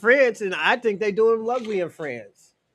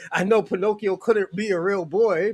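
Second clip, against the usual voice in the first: normal talking voice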